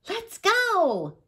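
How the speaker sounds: high, excited call to play; high young voice, raring to go; eager little fox. A narrator's reading.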